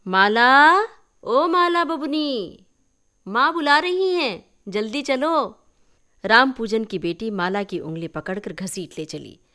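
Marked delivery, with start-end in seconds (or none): 0.1-5.6 high, loud call across the distance. Acted. Village girl calls Mala home to her mother, high and loud. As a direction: shout her name to fetch her home.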